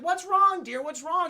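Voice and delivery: high-pitched, fussing mock concern; high, cooing retold voice; concerned older woman. Voices Pamela greeting crying Alice.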